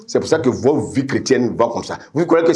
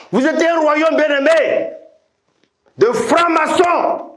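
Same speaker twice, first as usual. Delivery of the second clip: high, ringing, shouted litany; full-force preaching. Real, not acted. Preacher denouncing the congregation's former kingdoms, full-force preaching. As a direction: cry each phrase out high and sustained.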